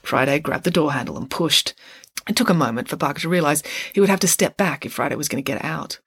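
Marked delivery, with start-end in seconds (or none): none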